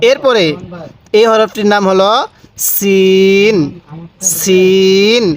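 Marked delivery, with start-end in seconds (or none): 2.7-5.2 সিন with a thin hissed s, vowel held long; thin seen. Shown as a demonstration. Teacher models the letter for learners, thin seen. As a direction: draw out সিন on a thin, sharp s. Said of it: "আওয়াজটা অনেক চিকন করব".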